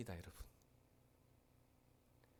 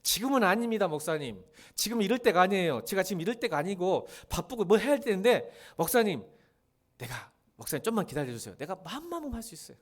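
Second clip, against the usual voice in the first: put-on louder, higher cocky young man brushing off the pastor; boastful excuse-making youth, louder and higher; mock stubborn youth; 3 semitones higher, 4 dB louder than usual. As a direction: play the brash young believer making excuses. Preacher acting a stubborn youth.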